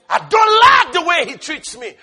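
imitating a resentful believer, loud; mock grievance. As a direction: whine it like a wounded churchgoer. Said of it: "quoting a grudging believer".

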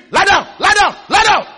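loud, high barked command; thief's scream. Retold or imitated. Thief ordering victims to the floor, screaming. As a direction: shout it sharply to terrify victims. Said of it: "he screams".

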